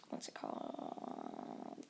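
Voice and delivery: very soft, slow whisper to yourself; barely audible self-prompt; whispered aside. Real, not acted. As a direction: whisper it while searching your memory. Aside while searching for a name.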